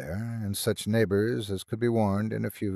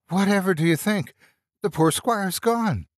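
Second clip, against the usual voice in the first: high-pitched, fluttering servant voice; a worried old housekeeper blurting out a death; agitated housekeeper; 10 semitones higher than usual. A narrator's reading. Housekeeper telling the rector the squire has died, agitated. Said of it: "in evident agitation".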